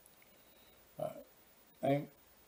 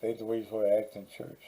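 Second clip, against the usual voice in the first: repeated faster for someone who missed it; 3 semitones lower, 3 dB quieter, faster than usual. Real, not acted. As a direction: say "That ain't the way you're supposed to act in church" again, quicker and firmer.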